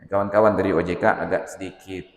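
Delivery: usual speaking voice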